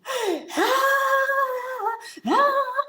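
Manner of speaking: hammed-up high sobbing wail; imitated crying. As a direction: wail in imitation of someone given bad news. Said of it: "I start crying".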